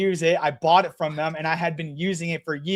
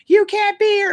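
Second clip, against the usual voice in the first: put-on high, loud scolding old lady; mock old lady telling him off; 13 semitones higher, 7 dB louder than usual.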